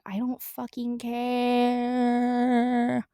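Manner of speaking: slow, dragged-out defiance; defiant. Retold or imitated. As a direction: drawl it out, not caring. Defiant drunk teen.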